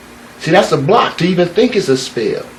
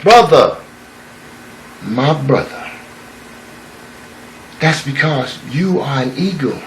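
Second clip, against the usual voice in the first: tender insistence; 6 dB quieter than usual. Acted. Old eagle gently pleading with the young eagle, soft and earnest.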